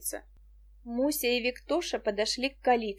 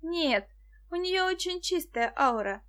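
higher-pitched, decided objection; firm assertion; 7 semitones higher than usual. A narrator's reading. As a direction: state it with mild resolve. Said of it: "решительно заявила Муся". (решительно)

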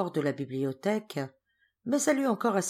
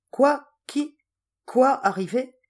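startled questions, high and loud; alarmed; 8 semitones higher, 7 dB louder, slower than usual. A narrator's reading. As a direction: blurt it out, caught off guard. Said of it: "Georges effaré". (effaré, pris de court)

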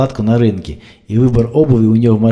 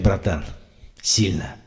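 softer lower praise; quiet respect; 4 semitones lower, 6 dB quieter, slower than usual. A narrator's reading. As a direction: say it low, man to man.

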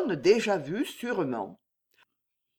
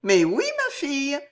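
higher, puzzled reply; surprised; 8 semitones higher, 4 dB louder than usual. A narrator's reading. Jeanne surprised by her maid's question, astonished. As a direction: answer as if caught off guard. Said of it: "fit Jeanne, étonnée".

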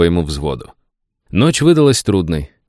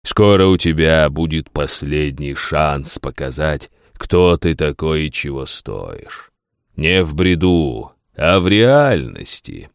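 low, slow alien voice; a slow voice from beyond; echoing ethereal energy being; 4 semitones lower, slower than usual. Acted. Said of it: "чужим, неземным голосом".

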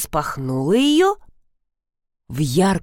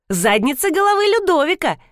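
put-on high, eager child clarifying; little girl's voice; 10 semitones higher than usual. A narrator's reading. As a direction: pipe it up like a small girl. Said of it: "уточнила кисуля". (высокий детский голосок)

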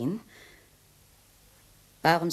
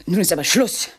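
loud, firm refusal; indignant outburst; 3 semitones higher, 7 dB louder than usual. Acted. Woman rejects the murder offer, loud and indignant. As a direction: put an end to it.

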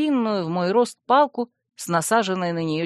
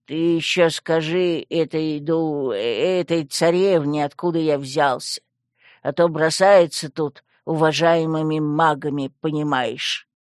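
grumbling skull; 3 semitones lower than usual. Acted. Skull complains about being thrown by the princess, grumbling. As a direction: grumble with wounded dignity.

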